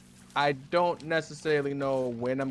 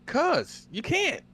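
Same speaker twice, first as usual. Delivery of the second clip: teasing; 13 semitones higher, much slower than usual. Acted. Teasing high slow answer.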